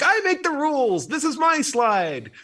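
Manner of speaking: put-on high-pitched, possessive little-kid protest; playground toddler. Host playing a kid guarding the slide, petulant. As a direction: stake your claim like a bossy three-year-old. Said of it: "a three-year-old at, like, a playground".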